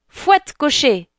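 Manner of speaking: high, mild storybook cry, drawn out. Acted. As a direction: call the order to the coachman. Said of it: "cria Cendrillon". (cri modéré, voix aiguë)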